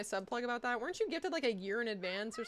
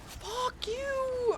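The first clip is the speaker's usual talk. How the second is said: angry yell; 4 dB louder than usual. Real, not acted. Angry outburst during game.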